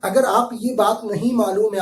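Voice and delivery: ordinary delivery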